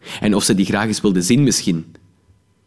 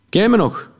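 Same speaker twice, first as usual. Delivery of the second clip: much higher, jovial question; friendly cop; 13 semitones higher than usual. Acted. Policeman asks if the narrator remembers him, jovial. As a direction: ask it beaming. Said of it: "vroeg hij".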